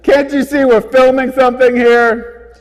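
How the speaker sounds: loud laughing yell; shouting. Real, not acted. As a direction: yell at the cars. Yelling at passing cars.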